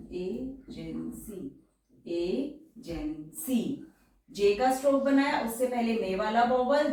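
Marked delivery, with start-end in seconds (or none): 0.1-1.4 agency drawn out slowly syllable by syllable, softer; slow teacher demo; slow and syllabled. Shown as a demonstration. 2.1-3.6 agency said very slowly, syllable by syllable, softer; slow and syllabled. Shown as a demonstration.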